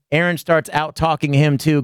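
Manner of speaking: usual speaking voice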